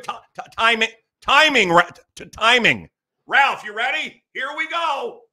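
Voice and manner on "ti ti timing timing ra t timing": flustered stammer. Real, not acted. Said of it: "good timing".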